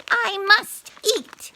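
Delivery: high, slow, panting craving; slow, breathless high plea. A narrator's reading. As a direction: pant the words out, desperate for food. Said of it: "he panted".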